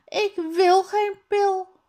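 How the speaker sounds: high, loud refusing shout. Acted. Tom refuses the teacher's pill, loud high shout. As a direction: yell your refusal like a stubborn child. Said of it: "roept Tom".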